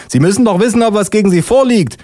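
high-pitched indignant policewoman; high, affronted official. Acted. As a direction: challenge him in a high put-on voice. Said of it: "ruft die Polizistin".

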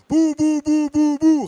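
put-on very high, loud baby-talk chant; aunt calling menacingly. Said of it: "rief sie erneut drohend".

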